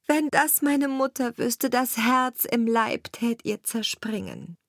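a hint of a grieving king's daughter; lamenting princess. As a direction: pour out her sorrow to the oven.

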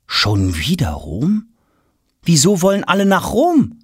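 baffled why everyone wants Rome; puzzled boy Tom. Acted. Tom wondering aloud to the servant.

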